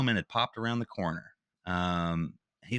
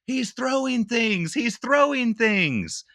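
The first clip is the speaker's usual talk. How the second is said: much higher, slow mock-whiny "he's throwing things"; mocking clerk; 13 semitones higher, 4 dB louder, much slower than usual. Mimicking the clerk who lied to campus police, high and slow. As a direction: parody her fake alarm, drawn out and high.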